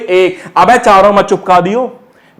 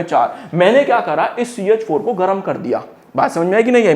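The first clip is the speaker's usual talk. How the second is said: usual speaking voice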